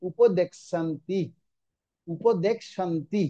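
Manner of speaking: slow উপদেক শান্তি with k and sh joined; demonstrated pronunciation. Shown as a demonstration. Teacher showing students the conjunct, উপদেক শান্তি slow with both sounds. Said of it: "তাহলে কি হবে".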